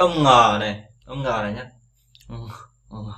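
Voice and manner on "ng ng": "Ng" ngân hơi qua mũi. Shown as a demonstration. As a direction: cho hơi ra đằng mũi, không ra miệng. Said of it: "Mũi này". ("Ng" phát âm bằng mũi)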